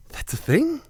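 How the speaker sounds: soft question leaping high at the end; imagined disbelief. Pretend. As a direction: mimic a listener who can't believe it. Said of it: "you're gonna be like".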